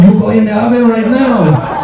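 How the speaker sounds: put-on laughing through a Mexican dad accent; mock Mexican accent, laughing. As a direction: play the scary dad in a jokey Mexican accent. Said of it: "wicked accent".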